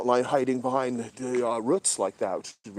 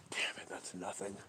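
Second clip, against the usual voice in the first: very soft, lower whispered grumble; 4 semitones lower, 16 dB quieter than usual. Real, not acted. Night filmer grumbling to himself at finding nothing, whispered. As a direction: whisper it under your breath. Muttered while scanning at night.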